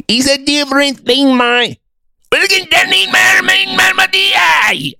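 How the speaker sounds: very high, hammed-up Scots gibberish; Scottish accent. Shown as a demonstration. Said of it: "noticed in that Scot accent".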